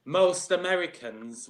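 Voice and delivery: usual speaking voice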